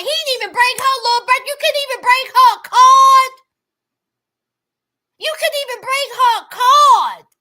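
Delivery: fast, loud indignant rant about the card. Real, not acted. Host ranting loudly that he brought no card. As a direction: build to a loud, fast outburst. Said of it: "ranting about the missing card".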